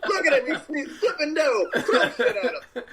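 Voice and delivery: high-pitched, hammed-up jeering; mock heckler. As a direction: play a rowdy onlooker egging others on. Mock hecklers watching pretzel worker.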